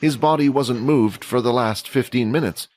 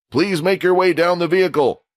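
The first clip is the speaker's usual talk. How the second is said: raised-pitch announcement to a crowd; general giving instructions; 7 semitones higher than usual. A narrator's reading. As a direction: instruct the assembled students as their general. General continues his instructions to students.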